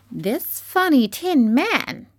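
high, slow squeaky queen; mouse queen voice. Acted. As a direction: regal tiny squeak. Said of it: "she answered".

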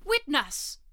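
high, loud herald's call. Acted. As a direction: announce it across the court. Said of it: "called out".

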